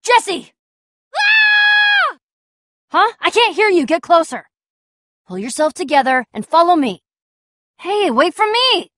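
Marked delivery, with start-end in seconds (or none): 0.0-2.2 name called out, then a high scream; long, very high scream after the call; panicked scream. Acted. Screaming for companion in danger. 7.8-8.9 high, drawn-out call; calling after someone. Acted.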